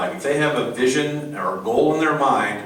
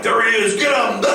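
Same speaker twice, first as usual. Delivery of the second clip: much higher, urgent soldier shout; bit part soldier; 11 semitones higher, 4 dB louder than usual. Shown as a demonstration. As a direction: call out the target like a grunt on patrol. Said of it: "Just bit part soldiers".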